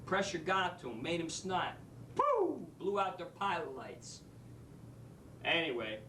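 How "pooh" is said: sudden high-pitched 'pooh' burst; quick, high mimicked puff; blow-out sound effect. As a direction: imitate something blowing out. Imitating pilot lights blowing out.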